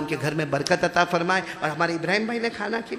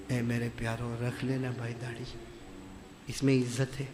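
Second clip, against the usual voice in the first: soft, low plea; tender appeal; 4 semitones lower, 5 dB quieter than usual. Real, not acted. Preacher softly appealing to his dear listeners. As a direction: gently urge them.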